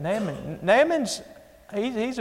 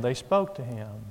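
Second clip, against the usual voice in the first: soft spoken; 5 semitones lower, 5 dB quieter than usual. Shown as a demonstration. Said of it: "They didn't shout at him".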